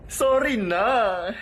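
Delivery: very slow, hammed-up whine; tearful apology. Friend apologizing over the hand, mock-tearful drawl. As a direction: drag it out like mock crying. Third take, exaggerated apology.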